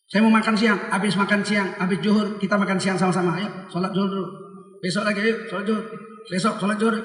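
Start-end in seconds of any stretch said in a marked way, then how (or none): none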